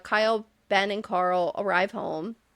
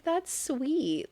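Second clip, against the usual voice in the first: melted; 3 semitones higher, 6 dB quieter than usual. Retold or imitated. Host moved by his goodnight kiss, tender and soft. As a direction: coo it softly, touched. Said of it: "that just made me melt".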